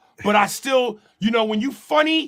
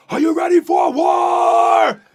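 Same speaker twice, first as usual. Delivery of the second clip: booming war cry, slow; rally the army before battle; 9 semitones higher, 8 dB louder, slower than usual. Acted. Braveheart battle cry acted.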